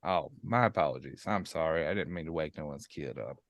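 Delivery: hushed, sheepish apology; quiet apology. Acted. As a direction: drop the voice, apologize softly. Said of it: "keep your voice down".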